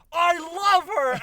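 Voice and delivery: high, loud and slow plea; the owner shouting love to a dog that only barks; loud, slow declaration. Retold or imitated. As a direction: declare it loudly, drawing it out. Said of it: "so you're just like".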